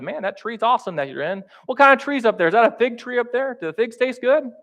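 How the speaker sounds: high, eager chatter up at the tree; hammed-up chatty small talk; mock small talk. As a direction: gush like a nosy neighbor. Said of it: "engage in small talk and said".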